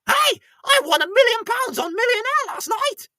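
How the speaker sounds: high, loud shout of a big win; excited winner. Pretend. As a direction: yell the news like a lottery winner. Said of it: "The traditional Scottish move".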